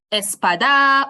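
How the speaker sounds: espada misstressed on the final DA; wrongly stressed last syllable. Shown as a demonstration. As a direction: push DA at the end of espada, loud and long. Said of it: "and not something like".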